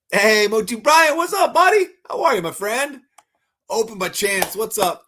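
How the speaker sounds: excited greeting. Real, not acted. Greeting a viewer by name.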